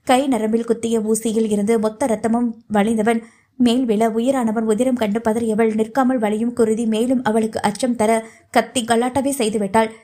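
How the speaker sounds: plain reading voice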